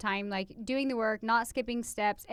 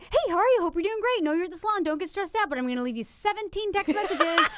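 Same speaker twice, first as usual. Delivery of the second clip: bubbly voice text; 6 semitones higher than usual. Retold or imitated. Leaving a cheerful voice text to a friend, bubbly. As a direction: gush sweetly before the list. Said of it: "and I'm like".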